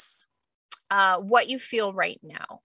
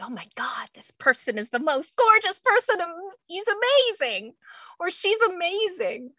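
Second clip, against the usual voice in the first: high gushing, louder voice; smitten gushing; 10 semitones higher, 4 dB louder than usual. Retold or imitated. Host voicing someone in the honeymoon phase, gushing. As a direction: gush like you're head over heels. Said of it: "You're like".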